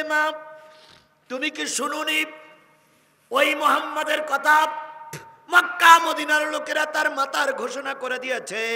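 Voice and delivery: high and loud throughout; preaching cry. Acted. Preacher voicing the son to his mother, loud. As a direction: deliver the son's words as a fervent cry.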